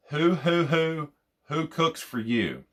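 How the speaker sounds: high, hooting owl call in the voice; mimicking an owl hooting; owl hoot phrase. Shown as a demonstration. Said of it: "when I say this, you're gonna laugh".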